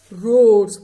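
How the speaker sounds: booming roared word; loud roar. Shown as a demonstration. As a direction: roar it out like a tiger. Teacher demonstrating tiger roar.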